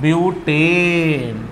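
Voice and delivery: very slow, stretched; drawn out. Shown as a demonstration. Teacher stretching the name so students copy. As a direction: lay the word out slowly.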